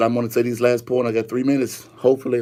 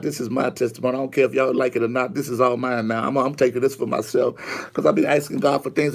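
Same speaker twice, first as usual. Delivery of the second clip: ordinary talk, nothing marked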